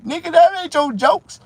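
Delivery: high, loud mocking voice of the joke thief; shrill put-on rival voice; mocking Ezal. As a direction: squeal it back like the rival comic. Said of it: "told me, said".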